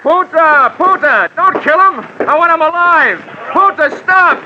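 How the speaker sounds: irate shouting. Acted. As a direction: yell at her to stop, furious and desperate. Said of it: "in the hands of an irate dame".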